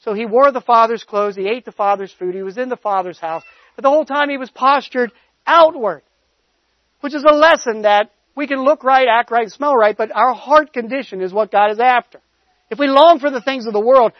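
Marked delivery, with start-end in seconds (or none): 3.7-14.1 louder, higher preaching build; fervent preacher pushing the lesson. Acted. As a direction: press the point home to the congregation. Preaching with rising force.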